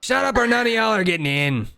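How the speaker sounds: doorman's high holler, not full force; dwarf yelling. Acted. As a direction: warn off a rowdy queue. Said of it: "he yells".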